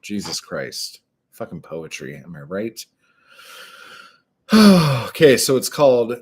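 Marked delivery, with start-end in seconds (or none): none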